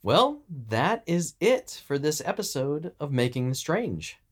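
high-pitched, upbeat scripted sign-off; podcast outro. Acted. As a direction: wrap up the show like a podcast host.

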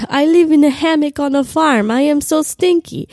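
mimicking the shirtless tour guide's accent; Costa Rican accent imitation. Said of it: "And he's like".

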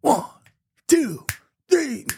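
high count-off sliding into scat. Retold or imitated. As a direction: count the band in, high and slow. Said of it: "and he goes".

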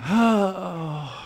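drawn-out falling "oh"; put-on sigh begging for attention; needy sigh. Husband wants his needs met by wife, needy falling sigh. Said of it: "did my breathing thing to get my needs met".

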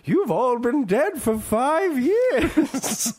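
high, very slow mock-dramatic announcement; stern FBI agent. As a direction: ham up the agent's grave line. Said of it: "in one of the opening scenes goes".

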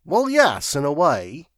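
high-pitched, vague reply. A narrator's reading. Secretary replying from the ledge, vague. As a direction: answer loosely, non-committally. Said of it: "replied the voice, vaguely".

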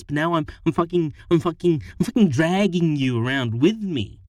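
loud, high tirade, speeding up midway; wife ranting at her partner, loud and furious; angry rant. A narrator's reading. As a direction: unload everything on him at once. Harriet's angry rant at Charlie.

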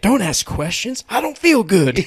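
high, whiny sick-man moan; man flu whimper. Retold or imitated. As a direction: whimper like a sulking patient. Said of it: "that man flu whimpering".

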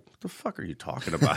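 lightly voiced baffled toddler; imagined three-year-old. Dad voicing his baffled three-year-old's look, mock bewildered. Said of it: "looks at me with those three-year-old eyes".

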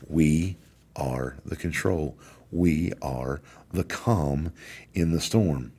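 drawn out very slowly, each word spaced; slow and deliberate. Real, not acted. Warden telling officers they are the calm, slowly. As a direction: let every word sink in slowly. Slow solemn climax line.

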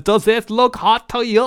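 high, loud mocking impression; hammed-up snooty customer voice. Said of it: "She's got her hand and says".

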